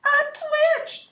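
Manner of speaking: very high loud witchy squawk; hammed-up witch cry; weird witch. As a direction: screech the punchline like a witch. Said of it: "the weird witch answered".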